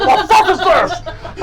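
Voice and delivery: high, loud and slow, laughing through it; cornered changeling yelling while a pirate slashes at him; baffled yell. Acted. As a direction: draw it out in stunned disbelief. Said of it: "He's like".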